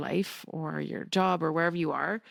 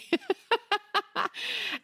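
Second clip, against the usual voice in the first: a burst of high giggling at herself; high giggle. Real, not acted. Laughing at calling a 5K run a marathon. Laughing at her own joke.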